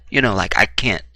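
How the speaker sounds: ordinary talk, nothing marked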